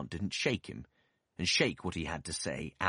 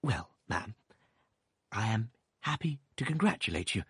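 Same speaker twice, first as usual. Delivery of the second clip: meekest manner; 4 semitones higher than usual. A narrator's reading. Doctor meekly congratulating the stern aunt. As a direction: offer it meekly, hoping to please. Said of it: "in his meekest manner".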